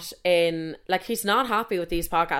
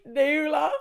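high, tearful wail of a friend's name; hammed-up crying down the phone; mock bawling; 9 semitones higher than usual. Bawling woman phoning her best friend, mock-tearful wail. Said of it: "bawling, crying".

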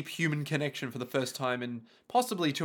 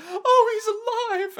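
very high, loud mock-weepy cry; overjoyed; 5 dB louder, slower than usual. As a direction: squeal with hammed-up relief. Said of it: "And Maria's like".

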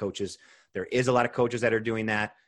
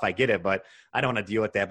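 normal talking voice

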